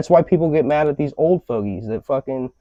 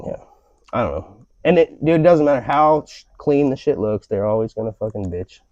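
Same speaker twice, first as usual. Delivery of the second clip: ordinary talk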